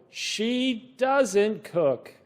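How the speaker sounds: ordinary talk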